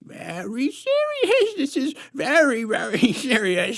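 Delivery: very high, quick, giggling insistence; giggly squeaky nanny. A narrator's reading. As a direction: titter it nervously. Nanny keeps insisting, laughing.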